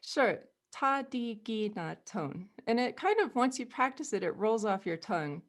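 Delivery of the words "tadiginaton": Tadiginaton said slowly, syllable by syllable; slow demo. Shown as a demonstration. Teacher repeats Tadiginaton slowly for a student. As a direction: spell out Tadiginaton carefully. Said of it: "So say that again".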